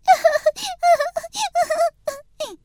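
high acted sob; the snail bursting into tears again; snail sobbing. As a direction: wail like a small crying snail. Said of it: "زد زیر گریه".

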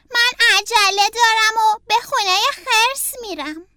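put-on a young turtle in a rush; hurried Franklin. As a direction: Franklin telling the fox he is in a hurry. Franklin voiced hurrying.

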